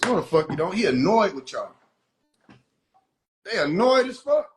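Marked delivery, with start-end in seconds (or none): none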